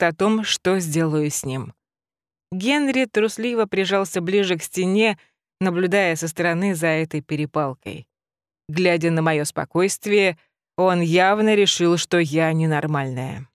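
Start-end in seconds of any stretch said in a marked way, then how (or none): none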